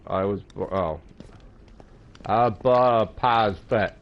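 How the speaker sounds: talking with tongue held; holding tongue with fingers. Shown as a demonstration. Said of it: "Hold your s-, tongue and say".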